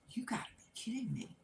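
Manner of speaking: whispery, quick aside in disbelief; hushed disbelief. Retold or imitated. Teller voices husband hearing about the first bark, hushed disbelief. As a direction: imitate a stunned husband, barely voiced.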